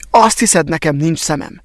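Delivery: ordinary delivery, nothing marked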